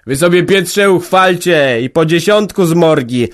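tipsy headman booming over the table; drunk village head. A narrator's reading. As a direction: lay down the law while half drunk. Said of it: "coraz głośniej mówił".

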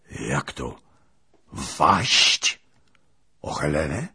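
put-on loud incredulous question from the old princess; can't believe what she hears; stunned disbelief. Princess questions the lieutenant, astonished.

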